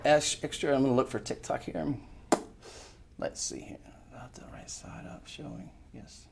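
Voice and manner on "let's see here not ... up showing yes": whispered note to self; a hushed aside while checking the screen; muttered whisper. Real, not acted. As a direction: say it under your breath.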